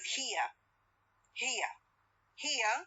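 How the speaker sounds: HERE ending in a vowel, no r; no linking r. Shown as a demonstration. Teacher demonstrating to students, HERE without the r sound. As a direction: say HERE as "hee-uh", r left silent. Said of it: "is without what? Without re sound".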